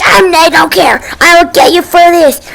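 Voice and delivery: mock angry threat. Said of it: "she, like, super mad".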